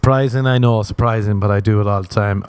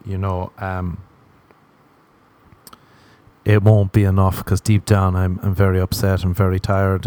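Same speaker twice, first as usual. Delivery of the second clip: normal talking voice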